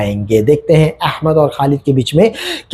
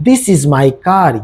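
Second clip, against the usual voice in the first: very slow, clear English for learners; slow model sentence; much slower than usual. Shown as a demonstration. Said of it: "example देख लेते हैं".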